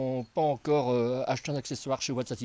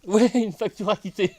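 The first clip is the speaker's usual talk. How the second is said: laughing; 7 semitones higher, 8 dB louder than usual. Real, not acted. Laughing joke about paid bill.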